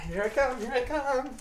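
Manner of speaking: high, soft teasing call; high sing-song voice. Pretend. Silly high announcing voice.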